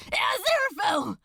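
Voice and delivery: high, loud, quick cry of the name; panicked call. Acted. Crowley calls to the slipping angel, panicked. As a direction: grab him with your voice.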